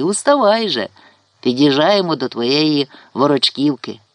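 usual speaking voice

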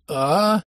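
rising drawn-out cry of release; ecstatic cry. A narrator's reading. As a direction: let out a long climactic "aaa". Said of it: "я сказал".

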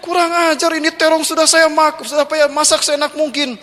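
high, upset complaining wife; a mock-indignant wife voice. Wife complains to husband about the thief, high and indignant.

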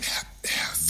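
breathless, whispered stammer of disbelief; stunned whisper. Acted. Director whispering Wessel's name in shocked disbelief. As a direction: whisper his name, too stunned to speak.

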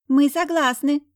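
put-on high, loud squeaky child voice; children's ragged chorus. A narrator's reading. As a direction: pipe up like eager kids in chorus. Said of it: "раздался из полумрака за её спиной нестройный хор баса и писка".

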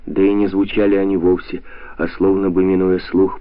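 ordinary delivery

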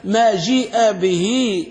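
"ما جيء به" very slowly drawn out; slow quoted text. Shown as a demonstration. Teacher quoting the author's definition slowly. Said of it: "قال".

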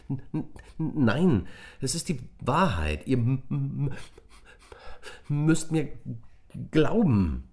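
put-on boy pleading desperately while stuttering. A narrator's reading. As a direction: beg them to believe you. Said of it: "sagte Nick verzweifelt".

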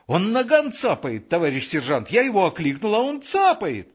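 high, loud-edged agitated report; excited shouting. A narrator's reading. Sentry justifying himself to the sergeant, shouting. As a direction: blurt it out high and indignant.

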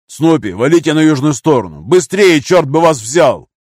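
loud, high, swearing urgency; shouted orders. A narrator's reading. Gunner hurrying comrades to safety, angry shout. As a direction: yell commands, then curse them on.

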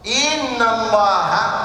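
slow, drawn-out إن الله as inna Allaha; الله ending in a clear a vowel; accusative ending. Shown as a demonstration. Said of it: "صار منصوب".

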